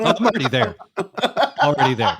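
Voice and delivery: high-pitched words squeezed through laughter; laughing. Real, not acted. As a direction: barely get it out for laughing, pitch climbing. Cracking up at friend's business idea.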